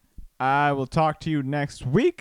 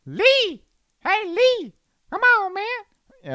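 much higher, yelling Rush Hour sidekick calling out; Chris Tucker impression; 4 dB louder, much slower than usual. Podcast host riffing on Hurricane Lee, much higher frantic calling. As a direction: squeal the name like a frantic buddy. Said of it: "I can't do a Chris Tucker impression".

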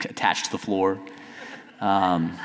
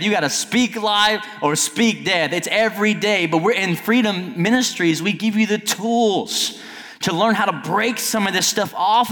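high-pitched full-force preaching, building higher; yelling; 11 semitones higher than usual. Real, not acted. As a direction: yell it out like an impassioned preacher. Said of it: "Sorry for yelling".

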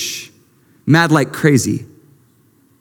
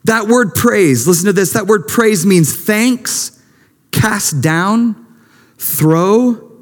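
high, ringing preacher's voice; full-force preaching; 6 semitones higher than usual. Acted. Pastor urging the church to praise, high and ringing. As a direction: lift the church with every line.

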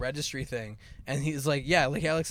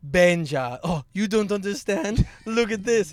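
imitating teacher; 4 semitones higher, 7 dB louder, slower than usual. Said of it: "he used to call me Benja".

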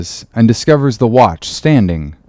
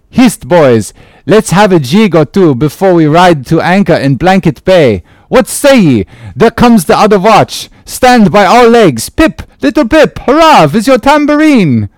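high, loud eager call to dance; lively French sailor; 9 semitones higher, 5 dB louder than usual. Acted. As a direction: rouse everyone to jig.

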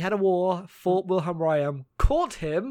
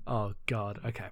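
ordinary talk, nothing marked

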